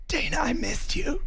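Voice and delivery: breathy mock-tender whisper; teasing whisper. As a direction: whisper it like a lovesick tease. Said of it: "I was teasing him".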